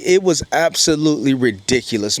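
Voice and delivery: ordinary talk, nothing marked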